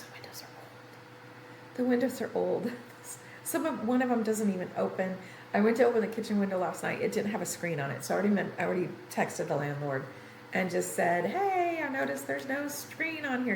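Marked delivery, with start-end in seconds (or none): 0.0-1.8 barely-there, drawn-out whisper; hushed whisper. Real, not acted. Hushed repeat of a complaint. 11.3-13.5 high, lilting retold message; breezy high-pitched note to a landlord; sing-song polite request. Retells polite text to landlord.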